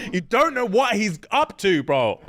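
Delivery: normal talking voice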